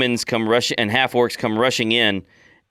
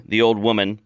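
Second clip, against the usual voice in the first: usual speaking voice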